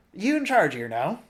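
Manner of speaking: high, loud demanding little man; small hoarse voice. A narrator's reading. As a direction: demand it like a tiny gruff creature. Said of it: "demanded in a small, hoarse voice".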